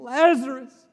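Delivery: loud, high call full of grief; weeping cry. Acted. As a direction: call him out through tears. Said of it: "he's crying, he's weeping".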